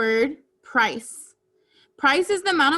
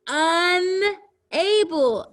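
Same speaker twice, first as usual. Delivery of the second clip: Unable slow and high, broken into un and able; split into word parts; 6 semitones higher, 4 dB louder, much slower than usual. Shown as a demonstration. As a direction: draw out UN, then say able separately in Unable. Word built from prefix plus base.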